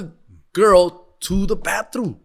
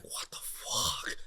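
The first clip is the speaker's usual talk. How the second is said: whispered shock; 12 semitones lower, 19 dB quieter, much slower than usual. Retold or imitated. Whispered in bathroom.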